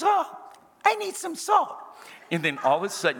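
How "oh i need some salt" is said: pitched way up, prissy and delicate; high mock lady voice. Preacher imitating the diner asking for salt, in a high prim voice. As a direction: play the fussy diner sweetly asking for salt. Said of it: "And she goes".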